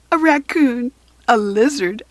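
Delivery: ordinary delivery, nothing marked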